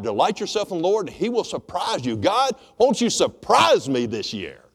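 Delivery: loud, fired-up preaching to the church. Acted. Pastor rousing his church, preaching at full force.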